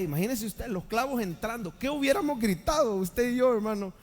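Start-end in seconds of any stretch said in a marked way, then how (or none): none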